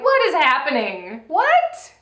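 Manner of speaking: baffled, pitch leaping up on the last word; incredulous. Real, not acted. Co-host on a recycled look, incredulous. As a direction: exclaim in rising disbelief.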